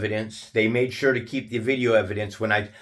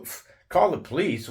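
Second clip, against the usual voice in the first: higher pitched, disbelieving echo of "call the police"; incredulous question; 6 semitones higher than usual. Retold or imitated. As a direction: throw his words back at him, baffled. Repeating his demand in disbelief.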